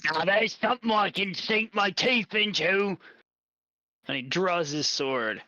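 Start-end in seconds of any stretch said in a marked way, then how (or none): 0.0-3.0 a hint of a grinning hobgoblin; lightly voiced sly monster, higher; gravelly sly hobgoblin. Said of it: "he grins and he says".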